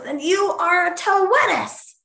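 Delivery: high, emphatic mock pronouncement; retold proclamation. Retelling what she was told she is, mock proclaiming cry. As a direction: declare it like a verdict from on high. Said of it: "then the Oscar goes".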